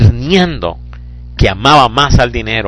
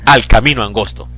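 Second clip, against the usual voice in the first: ordinary talk, nothing marked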